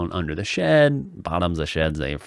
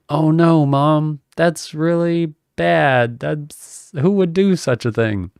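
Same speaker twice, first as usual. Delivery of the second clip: high, loud, fake shocked innocence; feigned innocence; 9 semitones higher, 5 dB louder, slower than usual. To mom about the burnt shed, feigned innocent shock. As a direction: play dumb, hamming up the surprise. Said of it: "my response was like".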